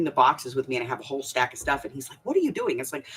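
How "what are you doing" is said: raised high, imitating him asking what she's doing; a hint of her husband's baffled voice; husband's puzzled question. Husband catches her hauling boxes, puzzled high question.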